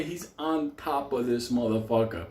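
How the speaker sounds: usual speaking voice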